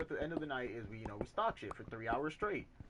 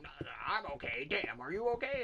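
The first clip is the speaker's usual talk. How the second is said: mild mock-irritated retort, rising higher and louder; imitated yelling; 4 semitones higher, 3 dB louder than usual. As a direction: act out the coworker turning the question back. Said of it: "why are you yelling".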